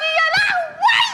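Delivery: high, slow comic shriek of dismay; laughing wail. Acted. Wife wailing over her bad luck, comic shriek.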